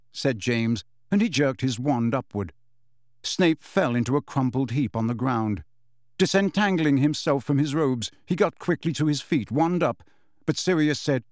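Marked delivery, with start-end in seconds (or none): none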